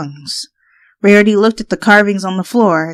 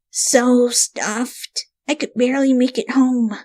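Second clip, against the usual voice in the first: sluggish, softer voice, full belly; stuffed and lazy; 6 semitones higher, 4 dB quieter than usual. A narrator's reading. Rainbow lies on her bed, too stuffed to move. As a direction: talk like you ate too much.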